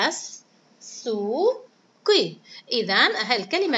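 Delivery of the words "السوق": السوق in three separate beats, as - soo - qi; very slow السوق with gaps between syllables; split into syllables. Shown as a demonstration. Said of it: "ثلاثة مقاطع".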